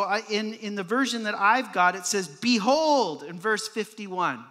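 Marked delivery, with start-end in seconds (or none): 2.3-3.2 "Behold" raised and loud, then falling; a ringing, drawn-out "Behold"; more emphatic. Acted. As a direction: proclaim "Behold" with full weight. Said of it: "he goes on even more so".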